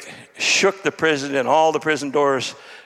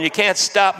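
ordinary talk

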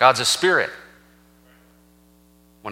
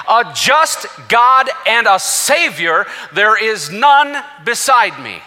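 high, slow scripture proclamation; full-force preaching; 8 semitones higher, 4 dB louder, slower than usual. Acted. Preacher proclaiming God's challenge from Isaiah, full-force preaching. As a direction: declare God's words with drawn-out, raised emphasis.

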